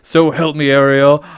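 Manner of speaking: loud, hammed-up royal threat; a furious father king warning; king threatening his daughter; King Triton threatening. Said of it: "King Triton".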